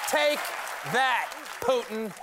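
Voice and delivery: high loud gloating jab; triumphant taunt. Acted. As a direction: shout it in triumph. Taunting Putin.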